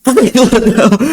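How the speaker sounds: high, fast, laughing disbelief; baffled question. Retold or imitated. Teacher puzzling over his student's words, high and laughing.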